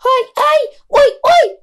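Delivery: high, tiny mouse squeak. A narrator's reading. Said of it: "squeaked Matty the mouse".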